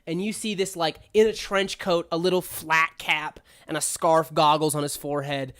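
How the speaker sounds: ordinary delivery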